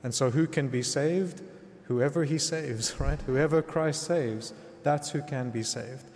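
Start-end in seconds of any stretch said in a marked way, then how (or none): none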